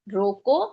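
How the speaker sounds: रोको with a rising end, then stop; रोको set apart as its own command; pause after रोको. Shown as a demonstration. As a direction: lift the end of रोको and hold the break. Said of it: "यहाँ पे रुके कुछ थोड़ा सा".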